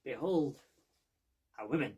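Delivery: loud, theatrical "behold"; loud mock-Diogenes unveiling; grand proclamation. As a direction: unveil it like a showman. Diogenes parody presentation.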